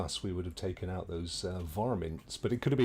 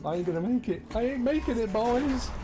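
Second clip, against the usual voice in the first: much higher, louder panic; panicked; 4 dB louder than usual. Real, not acted. Gamer cries out as his health runs out. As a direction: yelp it in a panic. Dying in game.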